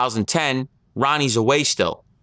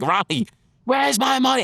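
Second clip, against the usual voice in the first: imitated screaming; 12 semitones higher than usual. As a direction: shriek it like you're demanding your money back. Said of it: "Just screaming on the top of his lungs".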